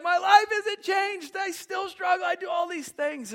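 high, loud, slow hammed-up outcry; mock shout. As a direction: cry out her frustration. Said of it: "She's like".

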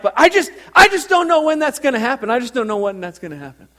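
fast, high parishioner protest, easing off; Teresa impression. Churchgoer telling pastor she's unsure when, hurried impression. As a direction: blurt it out high and hurried, then settle. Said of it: "That's exactly how she talks".

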